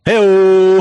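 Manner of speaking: big, loud, high hello; loud greeting. Real, not acted. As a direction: boom out the hello. Guest greets loudly on joining.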